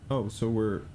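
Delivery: ordinary talk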